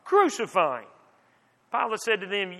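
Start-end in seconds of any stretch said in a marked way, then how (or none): 0.0-0.9 restrained cry of a crowd; mildly raised narrator's cry. A narrator's reading. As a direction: read as a measured call for punishment. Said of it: "they cried out".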